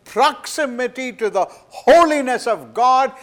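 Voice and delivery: loud, high, impassioned sermon voice; thundering pulpit preacher; full-force preaching. Acted. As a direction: preach it with rising fire. Preaching the vision of Isaiah.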